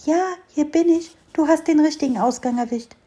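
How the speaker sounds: a hint of a small curious fir tree; little fir tree. Little fir tree answers mole. (kleiner Tannenbaum, leicht höhere Kinderstimme)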